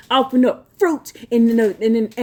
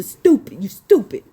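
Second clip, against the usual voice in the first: whispered insult; 2 semitones lower than usual. Real, not acted. Preacher scorning viewers who can't read, in a whisper. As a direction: hiss a contemptuous whisper.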